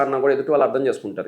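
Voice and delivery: ordinary talk, nothing marked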